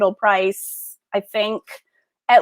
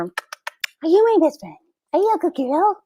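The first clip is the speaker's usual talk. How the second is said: pet talk voice; 3 semitones higher than usual. Real, not acted. Cooing to the pet.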